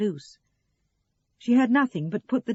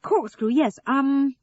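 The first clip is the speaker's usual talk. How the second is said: raised and louder, eager to be rid of him; distracted reply; 8 semitones higher, 8 dB louder, much slower than usual. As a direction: answer quickly to make him leave. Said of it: "she said, wishing he would go away".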